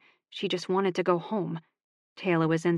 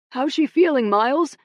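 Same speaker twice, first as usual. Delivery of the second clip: higher, louder friendly call; calling across a room; 8 semitones higher, 7 dB louder than usual. A narrator's reading. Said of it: "a voice called from the other side".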